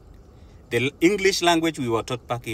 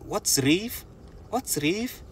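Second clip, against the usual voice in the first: puzzled question; 8 semitones higher, 4 dB quieter, much slower than usual. Retold or imitated. Confused coworkers asking back.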